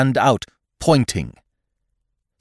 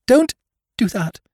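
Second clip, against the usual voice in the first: high, breathless frightened woman; gasped; 4 dB louder than usual. Acted. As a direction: gasp it out in alarm. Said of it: "she gasped".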